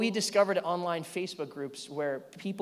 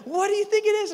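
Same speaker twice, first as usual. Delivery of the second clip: put-on high, louder child voice; curious little daughter; 7 dB louder, faster than usual. Daughter asking dad about the package, high. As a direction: ask it like an eager kid. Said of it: "And she's like".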